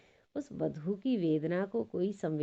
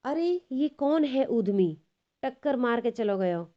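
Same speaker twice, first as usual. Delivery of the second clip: loud, higher, annoyed outburst at the one who bumped her; angry shout; 5 semitones higher, 6 dB louder than usual. Acted. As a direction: yell at the stranger who shoved past. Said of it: "गुस्सा तो बहुत आई". (गुस्से में ऊँची आवाज़ में चिल्लाना)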